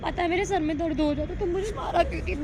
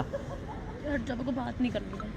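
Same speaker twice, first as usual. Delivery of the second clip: tearful refusal; 6 semitones lower, 9 dB quieter, slower than usual. Real, not acted. Crying girlfriend refuses to talk.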